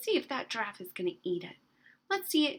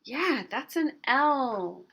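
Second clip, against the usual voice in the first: ordinary talk, nothing marked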